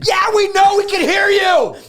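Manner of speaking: high, loud mock yell; shouted retort. Answering someone in a retold film scene, high shouting.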